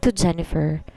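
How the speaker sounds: ordinary delivery, nothing marked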